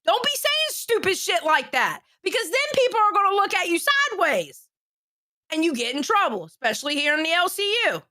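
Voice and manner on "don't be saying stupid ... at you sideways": stern warning. Real, not acted. Goes on warning Albert.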